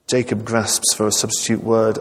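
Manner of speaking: ordinary delivery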